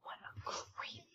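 whispered aside, barely audible; hushed grossed-out whisper; disgusted whisper. Real, not acted. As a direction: mutter it so he won't hear. Disgusted aside about teacher.

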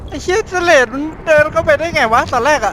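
loud, high burst of disbelief; shocked cursing. Real, not acted. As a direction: curse loudly at the shock, pitch shooting up. Cursing after sudden in-game ambush. (สบถเสียงดังด้วยความตกใจ)

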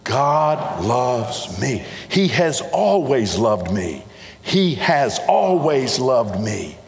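"God loves me" said very slowly for the crowd to echo; slow lead line. Shown as a demonstration. Preacher leading the congregation in a slow repeated affirmation. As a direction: lead the call slowly, one phrase at a time. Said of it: "Repeat after me, please, in full voice".

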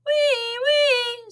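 very high quick siren squeal; sharp siren wee. Acted. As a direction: shoot out a fast siren wee. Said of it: "hard enough and fast enough".

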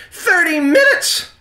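very high, loud shriek; exasperated piercing yell; frustrated blurt. A narrator's reading. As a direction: blurt it out at the top of the voice. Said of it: "blurted Victoria".

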